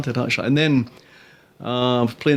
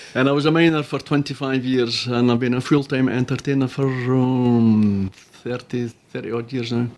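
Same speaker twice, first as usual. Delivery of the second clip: ordinary delivery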